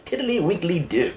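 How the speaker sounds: put-on failed Kiwi accent turned British; mock British accent. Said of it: "that's more British".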